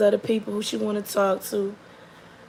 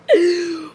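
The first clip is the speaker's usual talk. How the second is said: high loud laughing burst; real laughter breaking out; 8 semitones higher, 5 dB louder than usual. Real, not acted. Laughing hard at own story.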